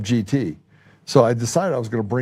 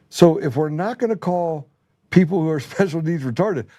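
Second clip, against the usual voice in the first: emphatic retort; 7 semitones higher than usual. Retold or imitated. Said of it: "I was like".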